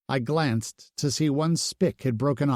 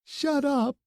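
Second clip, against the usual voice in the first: high, sharp cry to shut him up; upset snap. A narrator's reading. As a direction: cut him off, near tears. Bloom scolding him, upset.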